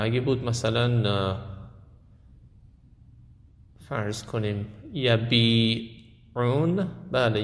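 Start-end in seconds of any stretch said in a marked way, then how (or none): 4.9-6.9 یبیعون very slow, the "ii" held long; long drawn-out vowel. Shown as a demonstration. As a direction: stretch the middle "bii" of یبیعون.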